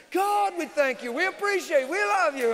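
high, loud, slowed group praise; loud congregation prayer. Retold or imitated. Preacher imitating the crowd joining in loud prayer. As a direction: mimic the crowd praying loudly. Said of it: "what people started doing".

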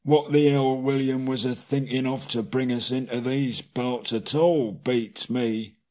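lightly voiced troll grumbling, slowing at the end; a hint of a sour troll blaming William; mild troll complaint. A narrator's reading. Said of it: "William choked".

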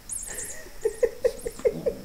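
very high airy giggle; squeaky breathless laugh. Real, not acted. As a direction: giggle helplessly at the cat. Laughing at cat.

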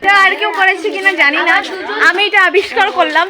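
loud voice ringing in an echoing hall. Real, not acted. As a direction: call out to test the echo. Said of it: "তোমরা এসে এখানে try করবে".

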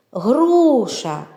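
Груша with a long, held first vowel; протяжно по складах. Shown as a demonstration. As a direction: sound out Груша, stretching the у. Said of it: "в назві яких є звук О". («Груша» протяжно, з довгим «у»)

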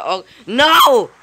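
loud, high cry of dismay; alarmed cry. Real, not acted. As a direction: cry out as it goes wrong. Sudden setback in game.

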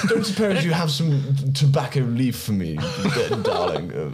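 mock-posh request, starting high and dropping on "darling"; hammed-up posh cadging, laughing; posh accent. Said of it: "do that so poshly".

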